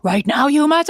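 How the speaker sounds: mock-barking an order at a kid; over-the-top mom. Mom imitating herself coming in over the top at her kid. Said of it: "when I come in over the top".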